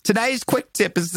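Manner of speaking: badly faked British accent, high and slow. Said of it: "I can't do it".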